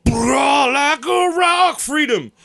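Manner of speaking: loud, slow bellowed commercial singer; truck ad voice. Retold or imitated. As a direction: belt it like a patriotic truck ad. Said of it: "Jeep Liberty commercial".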